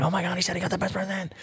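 put-on quick, high, hushed kid voices; mock kids buzzing about a present; mock excited kids. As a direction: imitate giddy little kids whispering. Kids mocked, quoted excitedly.

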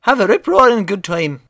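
put-on Irish accent. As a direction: fake Irish lilt, running on easily. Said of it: "saying these words in my Irish accent".

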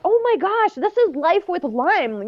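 squeaky high mock gasp of disbelief; mock shocked exclaim. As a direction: gasp it out, a high hammed-up oh my gosh. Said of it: "We're like".

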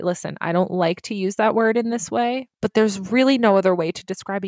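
ordinary talk, nothing marked

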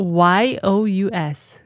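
letter by letter, slow; spelling it out. Shown as a demonstration. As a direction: spell each letter out slowly. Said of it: "Can you spell it?".

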